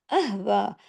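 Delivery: usual speaking voice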